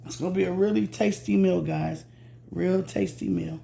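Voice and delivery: ordinary delivery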